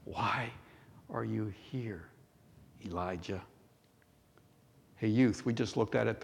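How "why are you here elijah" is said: hushed, halting question with pauses; gentle questioning. Acted. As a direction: ask it softly and low, word by word. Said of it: "Listen to the tone".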